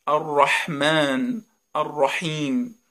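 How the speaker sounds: very slow الرحمن، الرحیم with constricted ح; rough, throaty ح. Shown as a demonstration. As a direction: stretch both words, ح squeezed from mid-throat.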